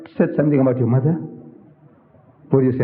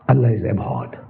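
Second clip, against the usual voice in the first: normal talking voice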